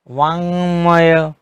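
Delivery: वाङ्मय slow, ङ then म clearly; slow model word. Shown as a demonstration. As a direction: stretch वाङ्मय so both nasals sound. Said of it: "यहाँ अं और म दो पंचम वर्ण आए". (वाङ्मय को धीरे, ङ और म अलग-अलग)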